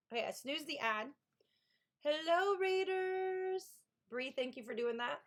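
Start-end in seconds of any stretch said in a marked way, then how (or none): 2.0-3.6 high bright hello to the crowd; cheerful welcome. Real, not acted. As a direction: call out a happy greeting. Welcoming raiders.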